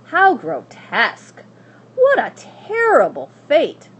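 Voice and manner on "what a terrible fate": ordinary delivery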